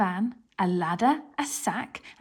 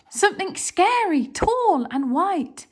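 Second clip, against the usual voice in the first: scared whisper-cry; 9 semitones higher, 5 dB louder than usual. Acted. The thieves spotting the ghost on the stairs, afraid. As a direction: draw it out in terror.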